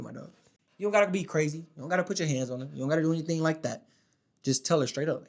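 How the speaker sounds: usual speaking voice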